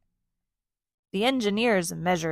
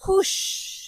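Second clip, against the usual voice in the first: high breathy whoosh; playing airplane with the kids; whoosh sound; 10 semitones higher than usual. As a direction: make the plane take off. Plane launch sound effect.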